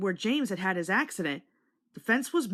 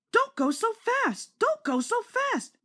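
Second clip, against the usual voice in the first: much higher, slow grumbling complaint; grumbling coaches; 14 semitones higher, slower than usual. A narrator's reading. Coaches complaining to James, slow high grumble. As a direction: drag out the words like a grumbling coach. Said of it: "grumbled the coaches".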